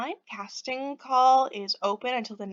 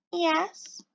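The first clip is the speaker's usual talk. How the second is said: timid reply; 7 semitones higher, 5 dB quieter than usual. Acted. Pixie answers the stranger.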